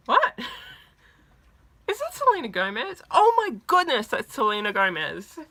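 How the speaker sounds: high, loud fan amazement; starstruck shock. Retold or imitated. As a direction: re-enact spotting your idol, disbelief bursting into excitement. Retold shock at seeing her idol.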